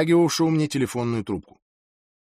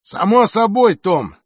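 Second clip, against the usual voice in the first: loud, raised, drawn-out condescension; patronizing; 6 semitones higher, 7 dB louder, slower than usual. A narrator's reading. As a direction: humour him slowly and loudly, sure of yourself. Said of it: "его голос звучал покровительственно". (покровительственно, громко и растянуто)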